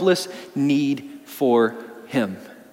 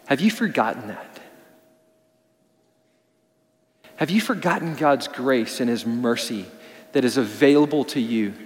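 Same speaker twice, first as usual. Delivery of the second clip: hushed appeal; 6 semitones lower, 6 dB quieter than usual. Real, not acted. Closing appeal, voice dropping.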